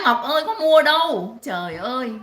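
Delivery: ordinary delivery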